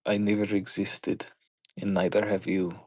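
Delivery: light attempt at a Russian accent. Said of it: "trying to do this in a Russian accent".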